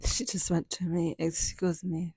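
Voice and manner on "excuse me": mock French accent, lightly done; imitating a French lady's accent. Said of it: "with this French accent".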